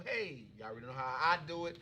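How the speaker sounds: ordinary talk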